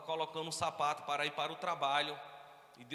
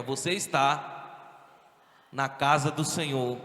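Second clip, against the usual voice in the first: slow, solemn prophetic proclamation; 10 dB louder, slower than usual. Shown as a demonstration. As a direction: announce it like a prophet, drawn out. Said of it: "mas fala com voz de profeta". (voz de profeta solene e arrastada)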